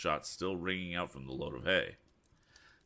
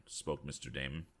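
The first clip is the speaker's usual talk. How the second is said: usual speaking voice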